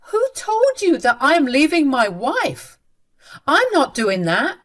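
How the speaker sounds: indignant son. Acted. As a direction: shut down the rumour hard. Son denies leaving wife.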